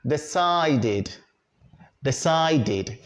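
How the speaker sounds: "decided" ending in a clear "did"; correct -id ending. Shown as a demonstration. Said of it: "You don't say decided".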